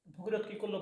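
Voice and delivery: ordinary delivery, nothing marked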